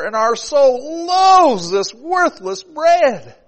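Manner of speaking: loud high slow reading; scripture complaint. Acted. Preacher reading the people's complaint, loud. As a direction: read the verse with mounting anger.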